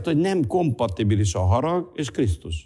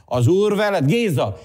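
fervent preacher with sustained raised pitch; impassioned preaching; 6 semitones higher than usual. Real, not acted. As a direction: proclaim it to the congregation. Preaches at full force.

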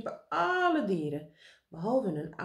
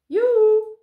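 high, very loud whoop; shouted; 14 semitones higher, 13 dB louder than usual. A narrator's reading. Karel answers meneer Pen while flying, shouting. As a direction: holler it from the air. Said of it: "riep Karel".

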